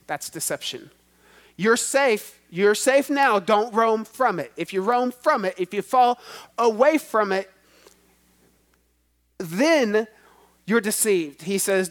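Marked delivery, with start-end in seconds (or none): 1.5-11.4 fervent preaching. Real, not acted. Preacher warning the church not to roam, louder. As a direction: press the warning home.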